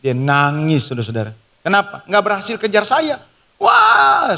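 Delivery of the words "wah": high, loud mock cry; imitated child's wail. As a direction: wail like a frustrated small child. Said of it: "saya lihat dia nangis".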